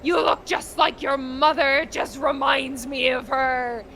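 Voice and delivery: loud, high, hurtful rage; bitter shouting dad. Retold or imitated. A father blaming his son for the mother's absence, shouting. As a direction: shout the blame at him, slowing at the end. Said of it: "And the guy's like".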